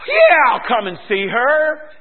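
loud, drawn-out eager agreement; changed attitude. Retold or imitated. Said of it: "it totally changed my attitude".

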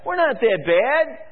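a smug priest brushing off the warning; priests mocked. Preacher mimics priests dismissing judgment, smug. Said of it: "the priests said".

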